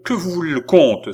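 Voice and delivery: loud, high-pitched question; cried-out demand. A narrator's reading. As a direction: cry out, demanding an answer. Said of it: "s'écria Philippe".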